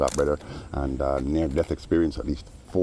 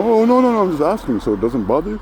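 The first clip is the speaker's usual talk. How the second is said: much higher, louder squeaky protest; mock innocent whine; 8 dB louder than usual. Teller mimics the man asking about Stevie, squeaky protest. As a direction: play the nosy guy backpedalling. Mocking the guy's prying.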